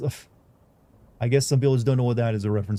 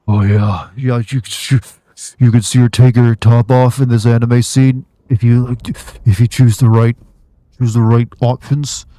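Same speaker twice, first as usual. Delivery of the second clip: loud, hammed-up dude voice hyping a scene; mocking porn game reviewer; 11 dB louder than usual. Streamer mocking a porn game reviewer, in a loud hammed-up voice. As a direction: bellow it like a cringey reviewer selling a nude scene, slowing on the last words. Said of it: "It's just some dude, it's like this".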